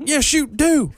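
high, hammed-up country twang; mock country retort. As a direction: tease back in a put-on country accent. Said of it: "I was like".

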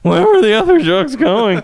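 high loud squeaky bit; practiced squeaky voice. Pretend. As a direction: squeak it out laughing. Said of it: "I really practiced that".

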